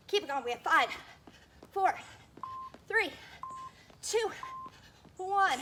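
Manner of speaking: loud countdown, pushing hard; barking gym coach. Real, not acted. Countdown near the end.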